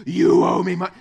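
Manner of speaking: loud harsh demand; angry demand. Acted. Servant yelling at his debtor for money. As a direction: bark the debt at him.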